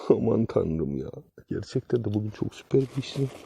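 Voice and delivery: usual speaking voice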